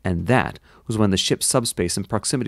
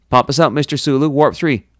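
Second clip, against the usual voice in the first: loud, raised, brisk command; urgent order; 5 semitones higher, 6 dB louder than usual. A narrator's reading. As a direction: fire off the order to the helm. Captain orders escape under attack.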